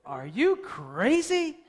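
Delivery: slow, disbelieving; like they've lost their minds; scoffing at the old couple's news; incredulous. Retold or imitated. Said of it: "You would go".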